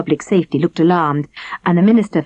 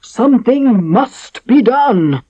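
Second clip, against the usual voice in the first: slow, higher royal shout; shouting king; 7 semitones higher, 4 dB louder, much slower than usual. A narrator's reading. King shouting at his council in despair. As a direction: bellow it down the council table. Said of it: "shouted the king".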